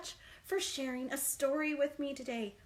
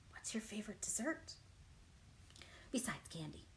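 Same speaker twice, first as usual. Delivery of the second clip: whisper; 5 semitones lower, 12 dB quieter than usual. Real, not acted. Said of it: "whispered question to kids".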